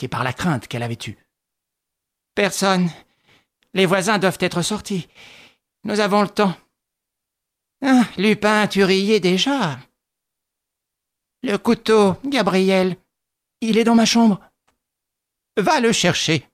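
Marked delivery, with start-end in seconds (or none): 2.3-6.6 put-on higher, halting old woman's disbelief; bewildered widow. A narrator's reading. Widow to her nephew, stunned and suspicious. 7.8-9.8 put-on slow, gloating old woman; mild taunt. A narrator's reading. Widow to captive Lupin, gloating slowly. 11.4-16.4 put-on higher, halting old woman's disbelief; slow, stunned questions; bewildered widow. A narrator's reading. Widow to her nephew, stunned and suspicious.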